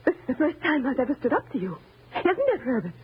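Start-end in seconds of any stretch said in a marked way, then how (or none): none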